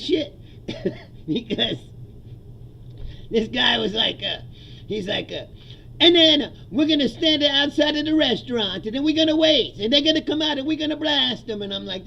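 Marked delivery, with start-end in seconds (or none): 6.0-11.6 high, loud cartoon Italian accent; Chico Marx. Sicilian mobster laying out the restaurant plan, cartoon Italian accent. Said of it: "cartoon character of an Italian accent".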